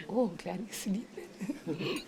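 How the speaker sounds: slow, soft, slightly sneaky voice; drawn out and soft, as if sneaking past a sleeper; hushed relief. Retold or imitated. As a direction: imitate a relieved sigh under the breath.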